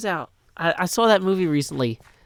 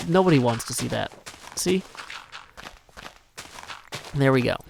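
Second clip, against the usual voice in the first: ordinary talk, nothing marked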